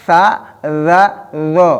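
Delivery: sa za zo with the z bitten; bitten letter sounds. Shown as a demonstration. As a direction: bite the z in za and zo. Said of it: "itu digigit ya".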